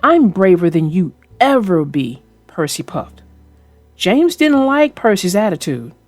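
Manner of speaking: ordinary delivery, nothing marked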